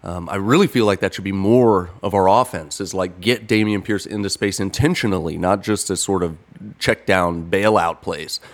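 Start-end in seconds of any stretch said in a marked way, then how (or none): none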